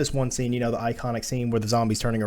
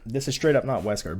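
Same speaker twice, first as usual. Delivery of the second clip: ordinary talk, nothing marked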